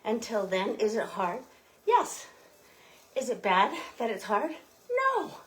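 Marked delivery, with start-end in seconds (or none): 1.8-2.2 high, punchy yes; emphatic yes. Acted. Answering her own question. 4.9-5.3 high, punchy no; emphatic no. Acted. Answering her own question.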